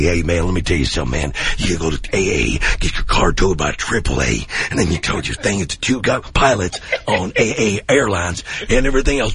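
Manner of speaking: low, fast, rambling Busey growl; Gary Busey impression. Comic doing Gary Busey in a trailer bit, low and fast. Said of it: "And Gary Busey".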